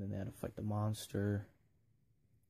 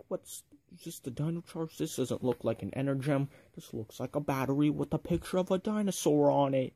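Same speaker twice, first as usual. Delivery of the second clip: mock villain scorn; 5 semitones higher, 6 dB louder than usual. Villain Sledge mocks the battery.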